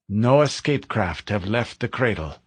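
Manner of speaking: ordinary delivery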